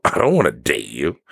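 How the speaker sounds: low, incredulous brush-off with a lift at the end; dropped-pitch, dismissive retort; bitch-you-crazy attitude. Retold or imitated. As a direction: reject them as if they were crazy. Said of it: "the way I said it was definitely like".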